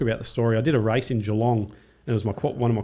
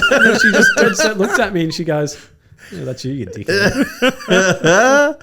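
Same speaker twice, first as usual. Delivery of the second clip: high, loud voice full of laughter; slow, drawn-out words squeezed through real laughter; laughing punchline; 10 semitones higher, 8 dB louder, slower than usual. Real, not acted. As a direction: crack up while delivering the punchline.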